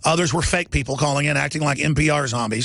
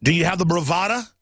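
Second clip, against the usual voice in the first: normal talking voice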